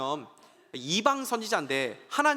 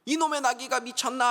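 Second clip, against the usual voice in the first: angry master; 6 semitones higher than usual. Acted. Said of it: "화가 나가지고".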